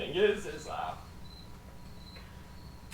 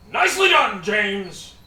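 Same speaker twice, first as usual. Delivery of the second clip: loud, raised commander's praise; commander calling out; 6 semitones higher, 13 dB louder, slower than usual. Acted. Commander to James as the door slams, loud call. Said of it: "you hear the commander go".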